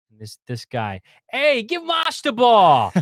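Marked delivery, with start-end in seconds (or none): 1.3-2.9 high, loud Boston fan hollering, drawn out slow; Boston accent, yelled high and slow. Retold or imitated. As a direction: belt it like a rowdy Boston fan in the bleachers. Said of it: "yelling in this awesome Boston accent".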